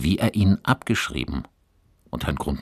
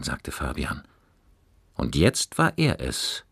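ordinary delivery

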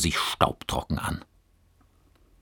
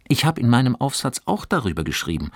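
quick, higher eager rush; 7 semitones higher, faster than usual. Acted. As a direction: rattle it off keenly. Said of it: "fuhr Johannes eifrig fort". (eifrig, schnell und etwas höher)

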